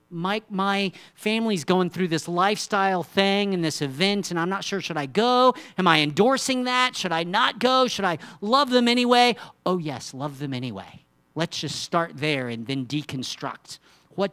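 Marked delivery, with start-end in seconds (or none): 0.1-9.3 higher, louder anxious asking; worried churchgoer. Retold or imitated. Churchgoer asking the pastor about family event, anxious. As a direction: voice an anxious member of the flock. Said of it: "People come and say".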